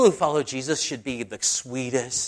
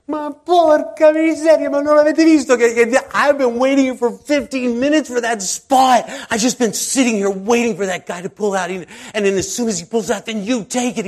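loud, high furious rant with Italian; hammed-up parking lot yell; Italian driver screaming at a stranger, mock rage; imitating an angry Italian driver; 10 semitones higher, 10 dB louder than usual. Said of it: "he started screaming at me".

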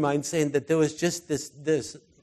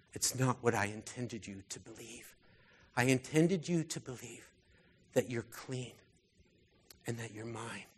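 tender reassurance; 4 semitones lower, 4 dB quieter than usual. Acted. God reassuring a troubled believer, softly and tenderly. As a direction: soothe them like a loving father.

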